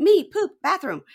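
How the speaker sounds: very high, loud toy voice; Tickle Me Elmo. Retold or imitated. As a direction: squeal single words like a talking doll. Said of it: "it sounds like Tickle Me Elmo".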